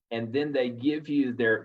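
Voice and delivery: normal talking voice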